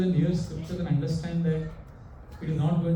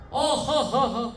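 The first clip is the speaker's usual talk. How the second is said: high, hammed-up "ha-ha" cackle; mock hysterical laugh; 8 semitones higher, faster than usual. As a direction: fake a burst of hysterical laughter. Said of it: "started laughing hysterically".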